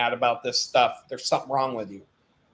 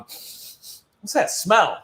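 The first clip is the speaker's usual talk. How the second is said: mock coworker sniffing; 4 semitones higher, much slower than usual. Coworkers voiced in story.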